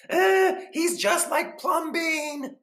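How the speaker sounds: high, loud and slow scandalized crowd; gossiping neighbors. Acted. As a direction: act shocked, drawing out each phrase. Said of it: "the people said".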